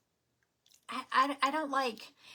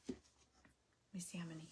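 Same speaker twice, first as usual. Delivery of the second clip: whispered aside; 5 semitones lower, 13 dB quieter, much faster than usual. Real, not acted. Counting prizes quietly.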